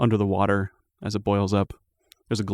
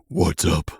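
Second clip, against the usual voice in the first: lightly voiced dragon, lower than usual; mysterious voice; 4 semitones lower than usual. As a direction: drop it lower for an ancient dragon. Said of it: "then you hear a voice say".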